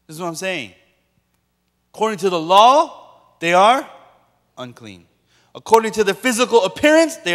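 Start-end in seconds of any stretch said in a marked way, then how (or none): none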